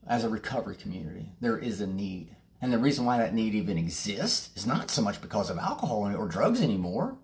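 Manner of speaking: usual speaking voice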